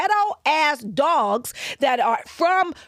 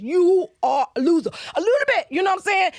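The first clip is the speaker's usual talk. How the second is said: rising rant; 6 semitones higher than usual. Real, not acted. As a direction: let it climb into a shout at the loser. Ranting at dog owners, shout heard.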